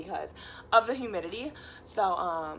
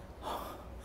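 a short unvoiced puff of air; winded exhale; breathless sigh; 13 dB quieter than usual. Real, not acted. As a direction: blow out a tired breath. Climbing stairs, out of breath.

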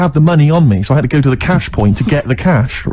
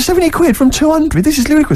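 high-pitched incredulity; mock disbelief, pitch shooting right up; incredulous; 13 semitones higher than usual. Buyer to seller over plunging price, incredulous.

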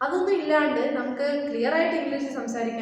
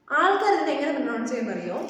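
ordinary talk, nothing marked

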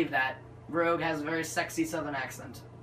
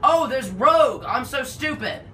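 loud, higher burst of realisation; sudden realisation; 8 semitones higher, 8 dB louder than usual. Real, not acted. Gamer spotting the needed character at last, exasperated excitement. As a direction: exclaim it, kicking yourself.

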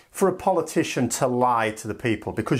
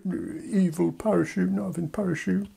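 put-on sulky toddler; 5 semitones higher, 3 dB quieter than usual. UK as toddler rejecting options, sulky grumble. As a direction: grumble it like a pouting kid. UK sulk inside EU speech.

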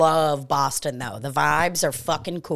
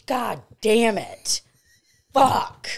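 drawn-out, higher exasperated curse; retold exasperation; 6 semitones higher, much slower than usual. Retelling walking back into the smoky room, exasperated. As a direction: stretch each curse out in mock despair.

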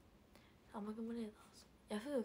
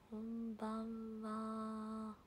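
very slow, stretched こんばんは in a put-on voice; YouTuber greeting; much slower than usual. Mimicking a YouTuber greeting chat, slow and put-on. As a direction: draw it out like a streamer's signature sign-on. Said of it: "トーアさんかな".

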